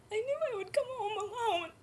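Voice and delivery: high, tearful wail through the words; breaking down crying. Acted. Birth mother knowing she would come home alone, sobbing. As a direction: let grief break the voice wide open. Breaking down over losing baby.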